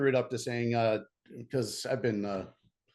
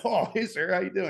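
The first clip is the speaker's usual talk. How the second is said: high, sheepish oh hey sir; suddenly polite, caught out by the captain; caught off guard; 9 semitones higher, 3 dB louder than usual. Retold or imitated. On the phone realizing it's the captain, sheepish and high. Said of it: "I'm like".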